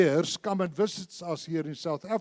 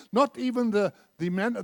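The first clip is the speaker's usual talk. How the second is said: usual speaking voice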